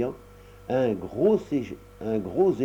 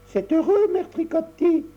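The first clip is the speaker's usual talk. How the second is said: put-on little girl; 5 dB louder than usual. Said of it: "répondit Tricotta".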